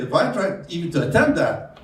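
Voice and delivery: ordinary delivery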